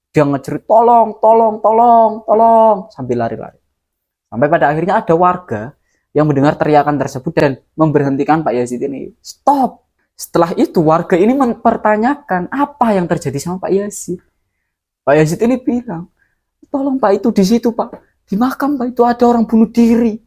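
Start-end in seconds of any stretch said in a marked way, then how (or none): 0.7-2.9 high, loud panicked cries of help; screaming for help. Retold or imitated. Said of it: "dia teriak ngejerit sepanjang jalan". 9.2-9.9 sharp high shout to halt him; shout stop. Retold or imitated. Villager stops fleeing man. 16.7-20.1 high, urgent, frightened pleading; panicked report. Retold or imitated. Panicked witness reports suicide.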